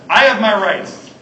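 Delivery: slow, loud, indignant insistence; mock a customer demanding their rights; hammed-up entitled demand at the counter. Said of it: "at a ticket counter saying".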